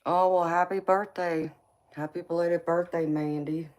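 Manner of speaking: normal talking voice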